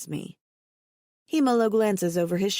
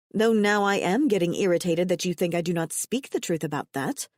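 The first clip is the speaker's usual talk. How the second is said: ordinary delivery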